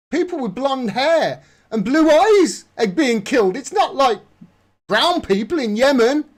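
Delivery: high, loud, sneering parody of his words; hammed-up imitation to ridicule him; mocking exaggerated quote.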